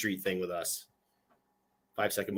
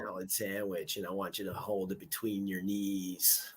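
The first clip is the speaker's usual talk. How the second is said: low, soft movie-star impression; hushed film-quote impression; Jack Nicholson; 3 semitones lower, 11 dB quieter than usual. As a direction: quote it quietly, in character. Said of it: "trying to do Jack Nicholson there".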